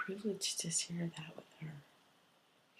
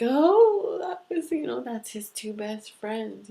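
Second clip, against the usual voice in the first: very high, loud mock exclamation, easing down; excited recognition; 7 semitones higher, 11 dB louder than usual. Client recognising husband's best friends, high excited outburst. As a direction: burst out high, then settle. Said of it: "she's like".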